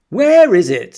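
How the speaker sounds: high, loud call from the crowd; shouted. Acted. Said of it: "somebody shouted".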